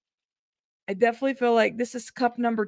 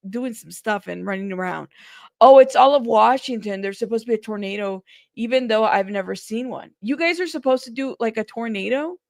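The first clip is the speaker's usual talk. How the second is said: ordinary delivery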